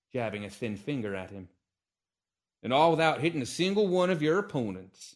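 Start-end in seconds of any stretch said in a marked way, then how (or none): 2.6-5.1 loud, higher sneer. Acted. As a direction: look down your nose as you mock her. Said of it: "Hiccup sneered".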